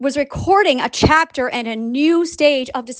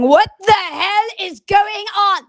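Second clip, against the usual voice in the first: slow, high mock-bewildered cry; baffled outcry; 7 semitones higher, slower than usual. Readers coming into the Substack, baffled outcry. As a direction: stretch each word in exaggerated disbelief.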